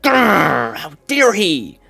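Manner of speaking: lightly voiced grumbling homeless person, a mock growl; mock angry growl. Mocking homeless people upset about tariffs, mock growl. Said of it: "they're like".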